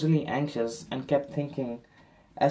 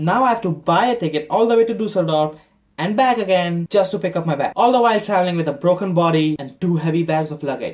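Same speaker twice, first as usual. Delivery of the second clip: raised, loud complaint; acted fed-up tirade; exasperated rant; 4 semitones higher, 6 dB louder than usual. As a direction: pile up the grievances, higher and louder. Ranting about the extra trip.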